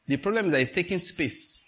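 usual speaking voice

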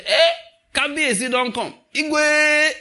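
high, loud hammed-up calling out; playful shouted praise greeting; mock royal hail. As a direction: hail him like a king, last word drawn out loud. Acting mock royal hail to husband.

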